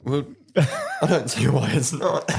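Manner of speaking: chuckling through the words; half-laughing as he answers; amused laugh breaking into the line. Real, not acted. Joking about dominating daughters.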